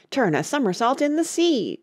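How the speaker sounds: high-pitched, wild exclamation; excited cry. A narrator's reading. Mock Turtle capering wildly about, crying out. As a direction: cry out the next dance move. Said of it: "cried the Mock Turtle".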